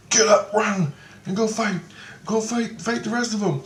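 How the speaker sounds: high-pitched imitated battle orders; urgent orders. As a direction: urge a fighter on in a high voice.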